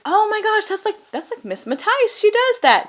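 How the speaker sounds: high-pitched retold burst of recognition; excited exclamation. Recognising a colleague's habit in the accent, acted excitement. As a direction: squeal it as a re-enacted realisation. Re-enacts own excited reaction.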